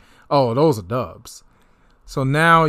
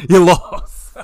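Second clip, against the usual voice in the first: jab it at the rival, louder and higher; gleeful louder taunt; 4 semitones higher, 5 dB louder than usual. Real, not acted. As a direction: rub it in with glee. Gloating over rival's predicted record.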